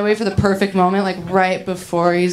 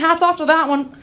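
higher-pitched, awkward stab at humor; nervous joking; 7 semitones higher than usual. Acted. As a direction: crack a nervous joke to ease tension. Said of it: "I got so nervous".

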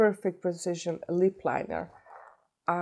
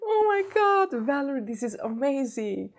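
high, delighted gush after a squeal; excited; 8 semitones higher than usual. Real, not acted. Vlogger thanking Valerie for the gift, high and excited. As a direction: gush in thrilled disbelief, high-pitched. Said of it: "I'm so excited".

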